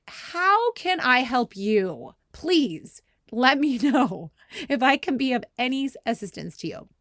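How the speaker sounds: slow, over-polite mock service voice with a laugh; exaggerated sarcasm. Wife mocking her husband mid-game, slow sarcastic politeness. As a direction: drag it out with fake courtesy. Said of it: "I was so annoyed".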